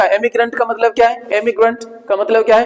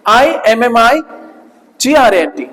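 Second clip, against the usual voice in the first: slow spelling out; much slower than usual. Shown as a demonstration. Teacher spells the word to show the double M, slowly. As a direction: spell आईएमएमआईजीआरएनटी letter by letter, slowly. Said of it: "double एम नहीं बोला जाता है".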